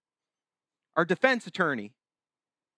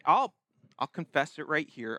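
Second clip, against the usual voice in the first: ordinary delivery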